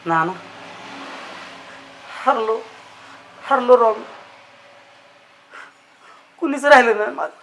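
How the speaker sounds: low, subdued tearful defeat. Acted. Dejected young man confessing defeat to Nana, tearful. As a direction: softly admit defeat, close to tears. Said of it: "अरे रडू नको".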